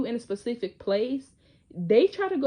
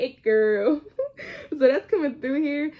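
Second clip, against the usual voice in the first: laughing; 5 semitones higher, 6 dB louder, slower than usual. Real, not acted. Laughs at her own lyric.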